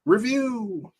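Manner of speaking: high, drawn out announcer call; catchphrase voice. Retold or imitated. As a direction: belt it like a catchphrase. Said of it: "That's his catchphrase is review".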